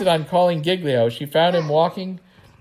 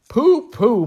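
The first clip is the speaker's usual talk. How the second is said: dismissive; 7 semitones higher, 5 dB louder than usual. Acted. Dismissive exclamation.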